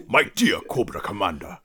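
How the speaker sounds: hammed-up Destro impression, rolling it grandly; playful imitation of a cartoon villain accent; mock Destro accent.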